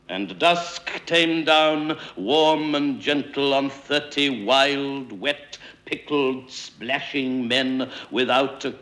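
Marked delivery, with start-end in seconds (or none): none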